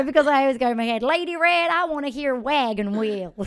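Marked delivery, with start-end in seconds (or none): 1.0-3.3 loud, higher mock Southern holler; Southern accent. Southern guest requesting a song from the singer, mock Southern holler. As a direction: imitate a Southern fan calling a request. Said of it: "I can only do a Southern one".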